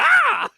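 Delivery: sudden high loud shriek of fright; startled scream. Retold or imitated. Girl caught snooping shrieks in fright. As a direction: jolt and shriek as someone appears behind you.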